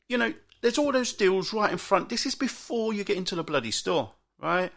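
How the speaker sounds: ordinary delivery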